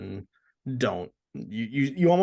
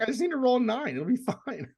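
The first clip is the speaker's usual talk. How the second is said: much higher, carefree voice; mock confident; 13 semitones higher, faster than usual. Quoting himself before failing the rolls, carefree and high. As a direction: shrug it off with false ease. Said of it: "I was like".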